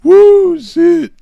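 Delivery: high, loud whoop after laughing; laughed-out exclamation. Real, not acted. Host cracking up at his own joke, high laughing whoop. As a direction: whoop it out through real laughter.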